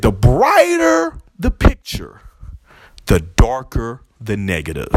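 slow, drawn-out delivery, dropping low at the end; dramatic Kingpin quote. Retold or imitated. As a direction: linger on it like a villain's wisdom. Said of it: "he said".

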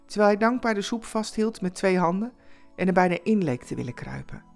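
ordinary delivery

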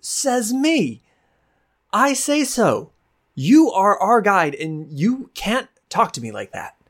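very high, offended small-woman voice; high indignant Akreya. Acted. Akreya standing up to her guide Marin, high and indignant. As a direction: puff up and insist, pitched high.

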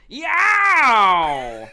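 loud, high, drawn-out whoop; drunken heckler yell. Acted. Floppy hat guy yowls at a man who fell, loud heckling shout. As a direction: belt out one long yowl. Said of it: "Floppy Hat Guy looks over and just goes".

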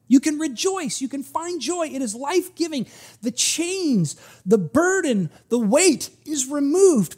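high, loud, jubilant preacher's build; exultant preaching of release; rising preaching. Real, not acted. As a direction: lift them up as the sermon climaxes. Preaching climax on joy.

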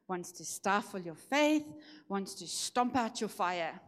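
normal talking voice

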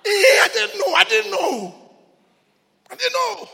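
high, loud anguished cry; preacher reliving not knowing, shouting; preaching at full force. Acted. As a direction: cry out the confusion. Preacher cries out confusion.

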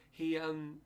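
ordinary talk

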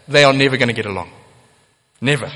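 NEVER driven home, fast then a lone "Never."; emphatic never. Real, not acted. Preacher to congregation, firm stress on never. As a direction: hammer the word never home.

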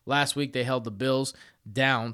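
ordinary talk

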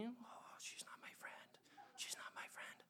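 very soft mock whisper; imitating whisper. Imitating the girlfriend whispering about Katie. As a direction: breathe it out, sulky and hushed. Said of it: "she starts whispering".

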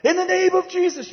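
mock loud prayer. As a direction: parody a high, showy public prayer. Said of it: "we don't pray out loud. We don't, like".

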